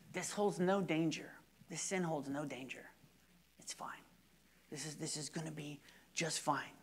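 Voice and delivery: soft, seductive devil's whisper; hushed voice of temptation; tempting hush. Retold or imitated. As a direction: coax them softly into sin. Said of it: "telling us".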